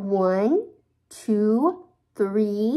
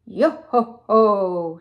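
slow, loud pirate cheer; shouted; 6 dB louder, slower than usual. Acted. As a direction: belt out a pirate yo-ho-ho. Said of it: "shouted Annie".

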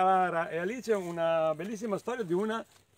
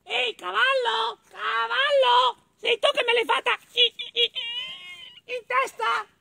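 little mole; 4 dB louder than usual. Acted. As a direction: squeal the accusation, stuttering at the end. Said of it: "Incontrò un cavallo".

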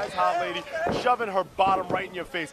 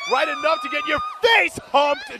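excited shout; 6 dB louder than usual. Real, not acted. Excited commentary punchline.

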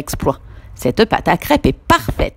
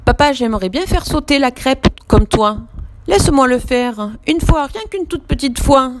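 put-on higher, begging child's voice; pleading; 8 semitones higher than usual. Samy begging papa to let him flip a crêpe. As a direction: wheedle papa for one turn. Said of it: "le supplie Samy".

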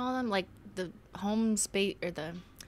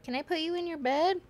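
put-on southern accent; 11 semitones higher than usual. Talking to her pet Minty, in a high Southern accent. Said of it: "Your southern accent is perfect".

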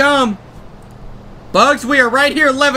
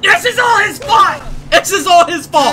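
angry shout; 4 semitones higher than usual. Real, not acted. Frustrated gamer blaming his lazy cartoon partner, angry shout. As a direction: blame him at the top of your voice.

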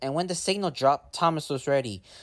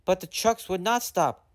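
plain reading voice

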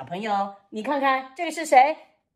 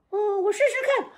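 puppet voice; 7 semitones higher than usual. Acted. Puppet Frankie tries kicking.

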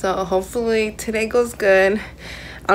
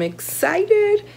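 excited squeal; 13 semitones higher than usual. Real, not acted. Fangirl gushing before meeting idol.

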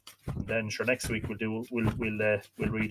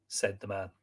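lively, dramatic read-aloud; expressive storyteller with acted dialogue; story narration; 5 dB louder than usual. A narrator's reading. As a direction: read the tale with wide swings of pitch.

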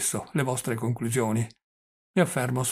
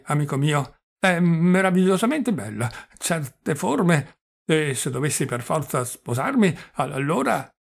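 put-on higher, stammering old man savouring a memory; doddering old prince, dreamy; 4 semitones higher, 4 dB louder than usual. Old prince telling his nephew about a beautiful woman, higher and stammering. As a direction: stammer fondly over the lovely dream.

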